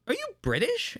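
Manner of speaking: lightly voiced coworker question; coworker asking. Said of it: "they're like".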